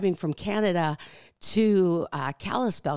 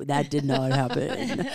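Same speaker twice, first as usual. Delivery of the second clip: laughing it off; 4 semitones higher than usual. Real, not acted. Laughs off the idea.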